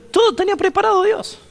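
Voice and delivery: high, ringing climax on todo; triumphant exclaim. Acted. The preacher marvels to the congregation, high and ringing. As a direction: lift the voice high in wonder at God's plan.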